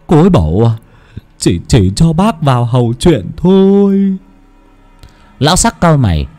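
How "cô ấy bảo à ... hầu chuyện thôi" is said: high, halting terrified stammer; stammering in fear. Acted. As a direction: stutter each word, scared stiff. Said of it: "mà lắp bắp". (lắp bắp sợ hãi)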